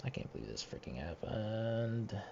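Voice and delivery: slow, soft, deflated disbelief; weary and fed up, slow; resigned disbelief. Real, not acted. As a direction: let it sag out slowly, defeated. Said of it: "Not in the mood to record".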